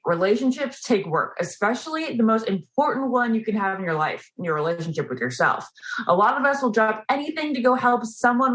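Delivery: ad read. Acted. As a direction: sell it like a podcast ad. Reads sponsor ad.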